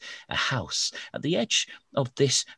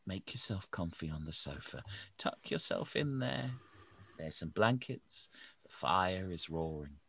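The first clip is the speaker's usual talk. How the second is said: very soft, calming host; soothing teacher; 10 dB quieter than usual. Acted. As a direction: settle the guest in gently.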